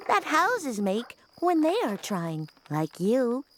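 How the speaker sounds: bedtime storytelling voice. Acted. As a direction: read it like a gentle bedtime story.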